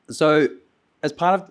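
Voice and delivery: losing voice. Real, not acted. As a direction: voice cracking into a hoarse whisper. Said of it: "really losing it".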